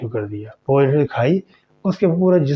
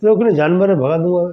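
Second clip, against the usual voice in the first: higher, louder telling-off; scolding shout; 5 semitones higher, 4 dB louder than usual. Acted. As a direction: shoo the animal away sternly. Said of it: "डांट डपट के ज़ोर से उसने कहा". (डांटते हुए ज़ोर से)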